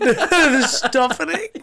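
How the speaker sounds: high, slow disbelieving echo; incredulous. Acted. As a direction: stretch it out in disbelief. Mock trailer reaction.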